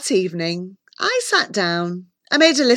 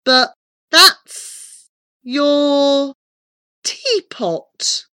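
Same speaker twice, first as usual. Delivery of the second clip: very slow, halting, higher-pitched puzzlement; bewildered; 6 semitones higher, 3 dB louder, much slower than usual. A narrator's reading. Visitor faced with a teapot as transport, bewildered. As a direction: draw each word out in disbelief. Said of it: "I said in bewilderment".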